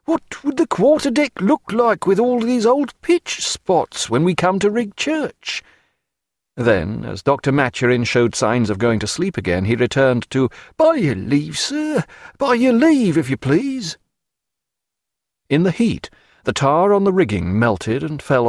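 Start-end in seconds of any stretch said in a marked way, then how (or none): 0.0-5.6 high sailor reasoning gently; high wheedling seaman voice. A narrator's reading. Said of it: "in a wheedling, reasoning voice". 10.8-14.0 sailor pleading. A narrator's reading. Sailor repeats his plea.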